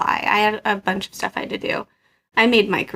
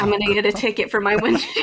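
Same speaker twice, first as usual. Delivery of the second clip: rushed, worried, with a laugh; quick panicked thought; faster than usual. Retold or imitated. Mom panics as the police car rolls up, fast and laughing. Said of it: "immediately I'm like".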